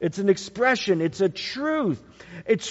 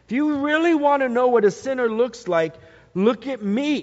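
voicing Paul; 6 semitones higher, 4 dB louder, faster than usual. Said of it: "That's what he's saying".

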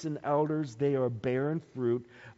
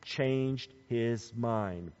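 "Changed his mind" slowly, word by word, lower; drawn-out weight on "Changed his mind"; slow emphasis; 5 semitones lower, 4 dB quieter, much slower than usual. Shown as a demonstration. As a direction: spell out the key point, "Changed his mind", unhurried. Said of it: "That's the emphasis point".

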